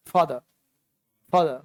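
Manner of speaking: फादर said as faadah, r dropped; silent r in father. Shown as a demonstration. Teacher drills father for students, r silent. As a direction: stretch the faa in फादर, no r. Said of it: "और फा बोला ऐसे".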